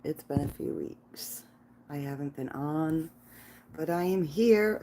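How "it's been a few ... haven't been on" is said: a hushed, intimate start to the stream; whispering. Real, not acted. Quiet opening of the live stream.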